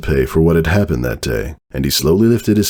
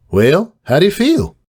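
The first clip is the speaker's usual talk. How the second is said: much higher, brighter pony voice; high character voice; 12 semitones higher than usual. Acted. Unicorn asking the coughing stallion, in a high voice. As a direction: ask it up high, checking in. Glimmer asks Mac.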